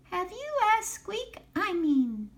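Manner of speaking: high-pitched, squeaky little mouse voice; nervous mouse. A narrator's reading. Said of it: "said Mouse nervously".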